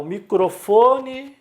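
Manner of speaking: MICROFONE high and loud, FO stretched; stressed penultimate syllable. Shown as a demonstration. Teacher naming the microphone as example, stress on FO in "microfone". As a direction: punch the FO in "microfone". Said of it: "paroxítona".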